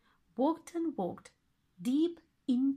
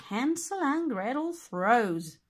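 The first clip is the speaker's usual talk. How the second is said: ordinary delivery, nothing marked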